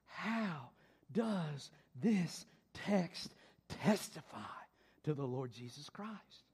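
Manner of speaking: low, soft, slow question; hushed question. Real, not acted. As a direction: draw them in quietly. Posing the key question.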